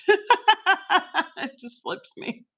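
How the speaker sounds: slow, high words through laughter; laughing. Real, not acted. Camera flips her frozen image.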